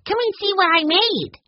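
put-on very high excited child voice; eager boy calling. A narrator's reading. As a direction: urge them over in a high little-boy voice. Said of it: "breaking into a run".

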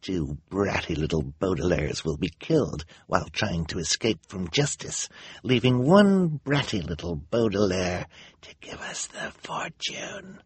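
hushed villainess sinking into a whisper; secretive, sinister, breathy at the ends; quiet menace. A narrator's reading. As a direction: threaten under your breath, savouring it. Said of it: "in a quiet voice so the audience".

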